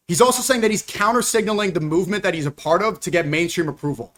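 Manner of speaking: ordinary delivery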